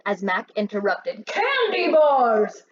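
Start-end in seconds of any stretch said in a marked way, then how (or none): 1.3-2.6 higher, louder, slow Candy bars!; shouted. Acted. Mac shouts the truck's real cargo at the thieves. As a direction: bellow it out slowly. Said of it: "Mac shouted".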